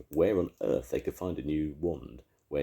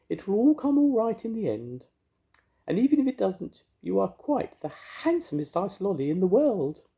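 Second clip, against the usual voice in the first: very high, louder fairy voice; comforting fairy; 6 dB louder than usual. A narrator's reading. Mavis comforting the ice lolly in a high fairy voice. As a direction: soothe him in a tiny high fairy voice.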